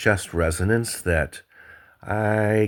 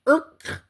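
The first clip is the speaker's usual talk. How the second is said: high squeaky yelp at the price; sudden high-pitched squeak of shock; startled yelp; 3 dB louder than usual. Retold or imitated. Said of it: "and went".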